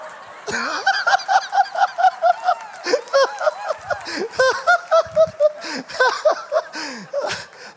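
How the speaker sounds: high choked laugh-cry; laughing sob. Real, not acted. Preacher overcome by the memory, laughing sob. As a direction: let the laugh break into a sob.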